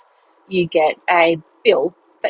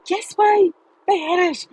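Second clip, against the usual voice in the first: excited insistence; 11 semitones higher than usual. Retold or imitated. Insisting to disbelieving mum.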